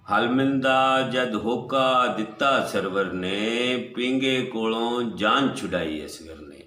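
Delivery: half-sung, sustained line endings; melodic verse recital. Acted. Poet repeating his couplet to the gathering, melodic recital.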